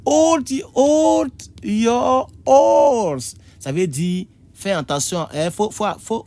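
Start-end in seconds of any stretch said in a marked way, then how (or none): 0.0-3.3 high, loud, drawn-out call; slow, high-pitched acted shout; acted plea to a shouting wife. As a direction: plead loudly, stretching "hold your horse". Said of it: "tu causes avec ta femme et puis elle crie sur toi".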